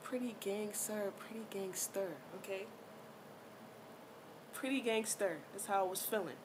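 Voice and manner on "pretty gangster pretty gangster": Nikki. Retold or imitated. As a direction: lightly imitate a cool, quiet brag. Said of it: "You know what Nikki says?".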